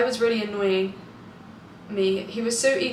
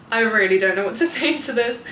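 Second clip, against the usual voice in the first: usual speaking voice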